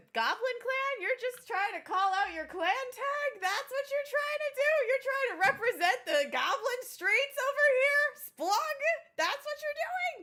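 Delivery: high, loud mock disbelief; incredulous teasing. Real, not acted. Player ribbing the goblin about his clan tag, high and incredulous. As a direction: tease him, voice climbing in disbelief.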